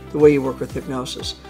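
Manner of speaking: ordinary talk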